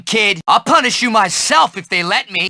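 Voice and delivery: ordinary delivery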